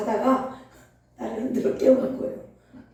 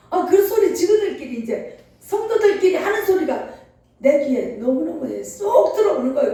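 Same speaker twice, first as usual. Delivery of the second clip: ordinary talk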